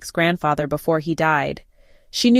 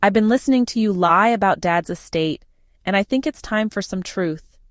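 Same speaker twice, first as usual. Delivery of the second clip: usual speaking voice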